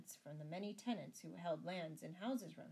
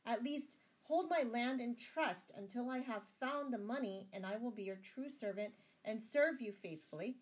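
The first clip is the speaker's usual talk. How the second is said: raised, urgent plea; pleading; 6 semitones higher, 6 dB louder than usual. A narrator's reading. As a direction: beseech the abbot in a higher, louder voice. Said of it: "pleaded Sir Richard".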